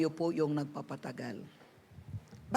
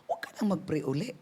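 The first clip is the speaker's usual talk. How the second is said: ordinary talk, nothing marked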